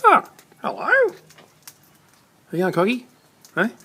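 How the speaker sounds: very high, drawn-out slow call to a cockatoo; high bird-talk greeting. Real, not acted. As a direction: stretch the greeting way up like coaxing a parrot.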